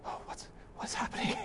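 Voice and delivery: hushed, whispered mock wonder; breathy, barely audible imitation of awe; imitating rapt kids. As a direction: whisper it like a spellbound child. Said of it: "and they're like".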